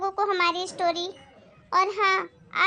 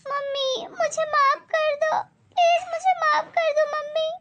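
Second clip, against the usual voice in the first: crying plea; 9 semitones higher than usual. Acted. Daughter begs her mother's forgiveness, crying. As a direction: beg through tears.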